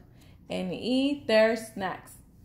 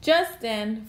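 usual speaking voice

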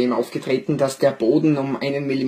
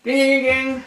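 high referee voice; 11 semitones higher, much slower than usual. Acted. Referee and bell sounds.

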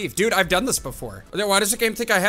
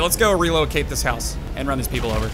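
usual speaking voice

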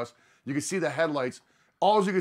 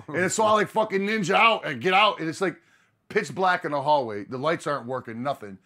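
usual speaking voice

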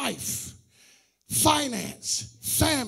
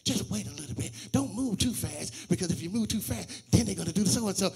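lower, much softer, a hint of a cautioning wife; imitating his wife; 6 semitones lower, 5 dB quieter than usual. Pastor quoting his wife's cautious advice, softly and lower. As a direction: counsel him gently and low, warning him to wait. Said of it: "my wife would go back and say".

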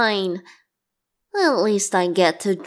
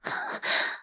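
fake gasp; 12 dB quieter than usual. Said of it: "you can't be falling for that".

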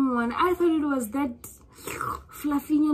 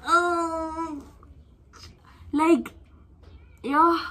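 real tears breaking in, higher pitch; tearful; 6 semitones higher, 4 dB louder, much slower than usual. Real, not acted. Vlogger thanking her subscribers, voice breaking into tears. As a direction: choke up with gratitude. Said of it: "I'm gonna cry".

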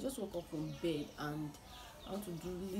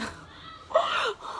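laughing cry; 7 dB louder than usual. Real, not acted. Camera broke, laugh-cry.

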